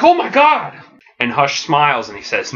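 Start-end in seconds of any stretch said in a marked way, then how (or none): 0.0-0.7 high-pitched shocked exclamation; horrified outcry. Acted. Robins watching Alfred get slashed, horrified outcry. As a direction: cry out in acted horror.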